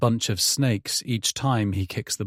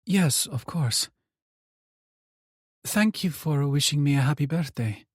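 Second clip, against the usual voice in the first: mother's voice; 5 semitones higher than usual. Acted. Mother on phone with son.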